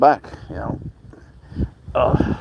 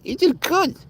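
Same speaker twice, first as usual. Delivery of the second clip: squeaky pet praise. Real, not acted. High praise to the cat.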